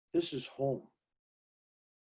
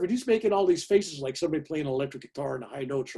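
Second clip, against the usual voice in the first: usual speaking voice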